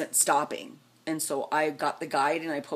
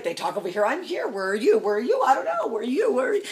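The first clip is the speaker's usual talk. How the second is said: frantic group chatter; 6 semitones higher, 4 dB louder, faster than usual. Retold or imitated. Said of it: "So it's like".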